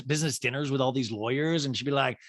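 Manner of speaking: usual speaking voice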